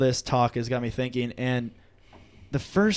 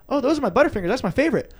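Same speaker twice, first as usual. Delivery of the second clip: high-pitched mock kid chatter; innocent kid; 9 semitones higher, faster than usual. Kid showing Papa Beal the Butterfingers, high innocent pride. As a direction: imitate a kid showing off candy.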